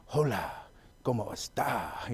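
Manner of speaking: put-on soft, slow smooth Spanish-accented hola; a suave Spanish accent, slow and quiet; Spanish-accented greeting. Friends using a Spanish accent to flirt, slow and soft. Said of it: "they could be like".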